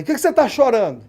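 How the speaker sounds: a mother demanding to know why he cries; mother catching her son in tears; mother's worried question. Retold or imitated.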